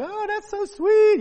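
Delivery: high, gushing sweetness; mock gushing. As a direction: coo it like a touched wife. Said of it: "they're like".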